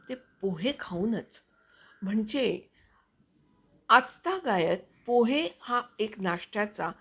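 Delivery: voice-over reading. Acted. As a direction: read it like an expressive voice-over. Reads her own voice-over piece. (उत्साही voice over वाचन)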